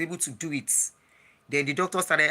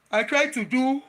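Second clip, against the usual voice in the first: yawning; 8 semitones higher than usual. Real, not acted. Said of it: "sorry, I'm yawning because of the deep breath".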